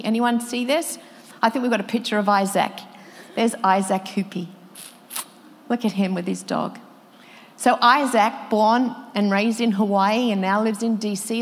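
ordinary delivery

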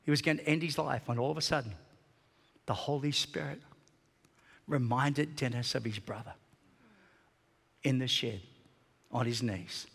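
ordinary delivery

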